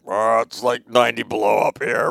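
mocking groan about the cold; whiny complaint, drawn into a groan; mock whining friend. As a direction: imitate a buddy griping about the weather. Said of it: "he's been whining his ass off".

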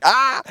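high mock yelp; a comic startled yelp; funny face cry. Imitating Jeff's reaction, a high mock yelp. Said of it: "Jeff always makes his face like".

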